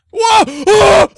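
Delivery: ordinary talk, nothing marked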